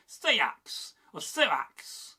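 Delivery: "Seax" with a slightly lengthened vowel; longer drawn-out vowel. Shown as a demonstration. Teacher showing West Saxon speech, drawn-out "Seax". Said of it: "a slightly longer vowel".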